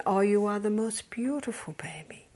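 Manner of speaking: put-on soft whispered coo to a baby. Grandmother feeding the baby, whispered cooing. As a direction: whisper adoringly, as to a feeding infant. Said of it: "what do you say when the baby's feeding".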